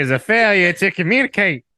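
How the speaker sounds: high, creepy Southern twang. Pretend. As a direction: play a creepy Southern warden. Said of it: "Southern twang".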